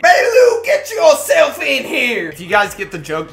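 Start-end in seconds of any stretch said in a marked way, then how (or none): 0.0-2.3 high, loud, slow Southern holler; Southern hillbilly call. Pretend. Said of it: "Bobby Joe and Betty Lou".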